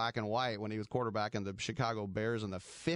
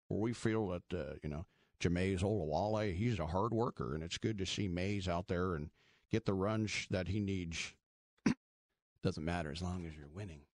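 southern accent impression; 5 semitones lower than usual. Del Rio at a press conference, in a mock southern accent. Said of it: "where he gets his southern accent".

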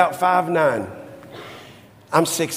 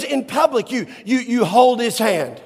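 mother scolding; 6 semitones higher than usual. Retold or imitated. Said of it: "She goes".

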